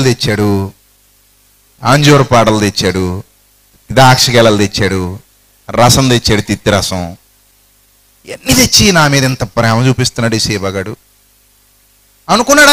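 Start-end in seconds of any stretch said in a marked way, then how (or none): none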